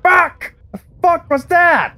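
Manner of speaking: high-pitched startled swearing; shocked, high-pitched yelp; startled outburst. Real, not acted. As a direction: blurt it out high, caught off guard. Startled by attack in game.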